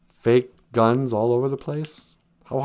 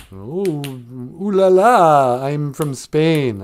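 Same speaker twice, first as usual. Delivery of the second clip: slow, drawn-out mock Spanish accent; badly faked Spanish accent, cooing and raised; 4 semitones higher, 5 dB louder, much slower than usual. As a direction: ham up a phony Spanish accent. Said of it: "I can't do a Spanish accent".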